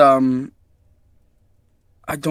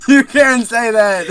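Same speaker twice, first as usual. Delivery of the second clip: mock scolding; 6 semitones higher, 6 dB louder, slower than usual. Repeated mock scolding, laughing.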